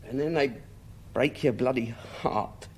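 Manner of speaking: dropping to a soft, broken low; voice breaking. Acted. Del to his brother about family, voice breaking. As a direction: let the last words crumble. Del's grief breaks through.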